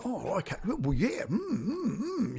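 high, strained polite noises; squeaky, trapped polite murmurs; awkward stranger. Retold or imitated. Stranger in a lift humouring you, high strained murmurs. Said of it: "you can actually see them going".

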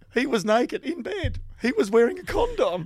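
high, drawn-out tabloid headline delivery; mock headline recital. Reciting outrageous tabloid headlines for laughs.

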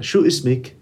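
Lebanese Arabic dialect question; Lebanese accent. Shown as a demonstration. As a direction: ask it the Lebanese way. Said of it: "we're gonna use the Lebanese one".

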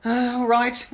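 shocked at the sight of blood; startled all right. Retold or imitated. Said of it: "she's like".

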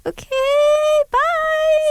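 high-pitched, mock awkward brush-off; awkward okay bye. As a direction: squeak out an uneasy goodbye. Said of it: "And I'm like".

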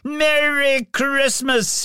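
high, slow joyful call; shouted greeting. A narrator's reading. Scrooge shouts to astounded neighbours, high and slow. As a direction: call it out across the street. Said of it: "he shouted to his astounded neighbours".

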